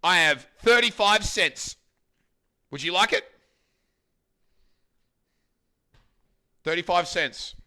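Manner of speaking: loud, high hammed-up joy; mock delight. Streamer offering coins to Jones, hammed-up delight. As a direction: gush over the coins. Overjoyed finding coins in wallet.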